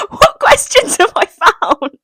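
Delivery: high, loud laughing squeal; laughing disbelief. Real, not acted. Vlogger appalled by a question about her childhood, squealing with laughter.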